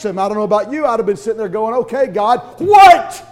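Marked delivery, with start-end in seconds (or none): 1.7-3.2 high, loud outraged what; exasperated what. Acted. Imagined man bitten by a snake, yelling up at God. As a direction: throw up your hands at God. Reacting to snakebite after shipwreck.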